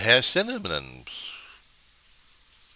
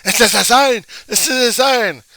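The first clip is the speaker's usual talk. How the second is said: high, very loud repeated yell; screaming and yelling; 11 dB louder than usual. Acted. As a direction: scream it at passers-by. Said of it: "screaming and yelling my head off at people".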